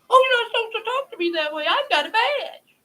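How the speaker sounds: mock the offended official; mock whiny inspector. Host mocks offended inspector.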